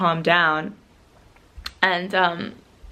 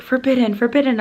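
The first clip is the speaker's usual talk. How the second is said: higher, louder mock yell; retold yelling; 7 semitones higher, 5 dB louder than usual. Angry shopkeeper to vlogger over filming, mock yelling. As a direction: imitate a man repeatedly yelling an order. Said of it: "he just kept yelling".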